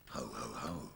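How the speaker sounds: low, soft Santa ho ho ho; a deep jolly Santa chuckle; Father Christmas laugh. Acted. Father Christmas arrives in the girl's room, low soft laugh.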